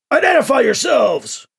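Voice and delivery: high, loud military shout; shouted challenge. A narrator's reading. Soldiers flanking two arrivals at the station, shouted challenge. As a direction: shout it as an order to intruders.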